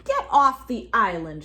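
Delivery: loud shout. A narrator's reading. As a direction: shout them off the island. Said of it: "shouted Rupert".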